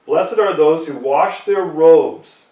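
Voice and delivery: slow, loud and weighty; emphatic scripture reading. Acted. Preacher lands the key verse for his flock, slow and loud. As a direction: lean on every word of the verse.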